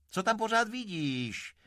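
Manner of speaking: high, loud question to the horse; vozka okřikující koně. Acted. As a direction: call out sharply to your horse. (hlasitá, vysoká otázka vozky koni)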